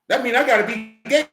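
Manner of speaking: ordinary talk, nothing marked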